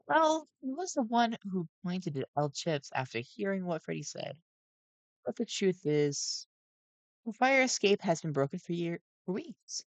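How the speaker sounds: high, nervous animatronic voice; jittery gentle robot voice; Sun voice. A narrator's reading.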